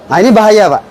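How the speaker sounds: ordinary delivery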